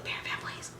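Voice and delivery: very soft, voiceless mock pleading; whispered plea. Fan begging [name] to stop, whispered plea. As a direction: beg him under your breath. Retells pleading with laughing idol.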